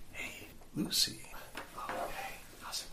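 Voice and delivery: soft, whispery dream visitor; hushed greeting. Acted. Al McCool calls Lucy in class, hushed and secretive.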